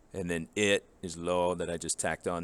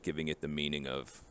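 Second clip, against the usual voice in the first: usual speaking voice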